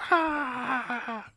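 high cry of pain. Retold or imitated. Imitating Luke losing his hand.

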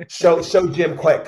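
a hammed-up eager push to show off; eager urging. [name] eagerly urging the shaman to show Jim Quick. [name] putting him on the spot.

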